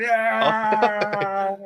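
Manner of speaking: long, high, loud held scream; mock movie-villain death cry; imitated yell. Imitates Nazi yelling on the tank.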